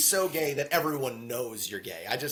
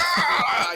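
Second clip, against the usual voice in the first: hammed-up high war cry; mock battle yell; 12 semitones higher, 7 dB louder than usual. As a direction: yell it like a charging warrior. Acted battle cry in retelling.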